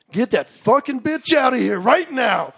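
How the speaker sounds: high, loud, slow imitation of an angry order; furious mock shout. Cousin refusing the girl at the yurt door, furious mock shout. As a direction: shout it high, dragging out each word.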